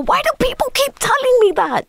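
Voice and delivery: very high, hammed-up desperate whine; comic despair, squealed way up high; exasperated retold wail. Answering a director's praise, exasperated.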